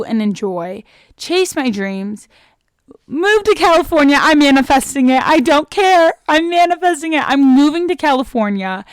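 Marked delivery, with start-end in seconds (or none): 3.1-8.9 loud, higher-pitched, fired-up vow; defiant declaration. Real, not acted. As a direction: declare your dream over all the doubters. Defiantly declares her dream aloud.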